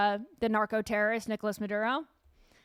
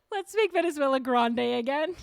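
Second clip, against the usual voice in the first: laughing mock Spanish; 4 semitones higher than usual. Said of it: "Perfect Spanish".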